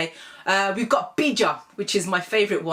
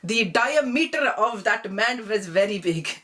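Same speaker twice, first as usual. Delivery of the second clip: put-on mum's Desi accent; slower than usual. Imitating mum saying "diameter" in a Desi accent. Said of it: "she'll always say diameter".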